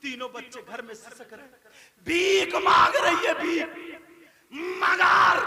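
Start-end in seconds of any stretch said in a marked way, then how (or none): 2.1-5.4 loud, high preacher's cry; sudden high burst of pulpit emotion; impassioned outcry. A narrator's reading. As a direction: cry it out to the crowd in outrage. Preacher cries out at the begging.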